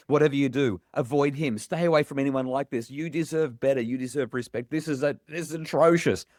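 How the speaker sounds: imagined warning to a daughter, urgent and emphatic; protective urging. Retold or imitated. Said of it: "I wanna protect her and say".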